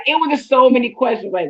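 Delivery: ordinary talk, nothing marked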